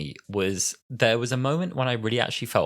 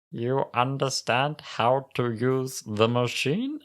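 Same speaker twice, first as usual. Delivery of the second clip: very slow, struggling Cyberman; sad low-battery voice; 4 semitones higher, slower than usual. Retold or imitated. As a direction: drag the words out as power fades. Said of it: "sad, low battery voice".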